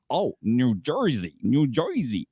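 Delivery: drawn-out imitation of a New Jersey accent; mock New Jersey accent. Random Australian echoing the place name back, mock New Jersey accent. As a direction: say it slowly in a put-on Jersey accent. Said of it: "they say it with that accent".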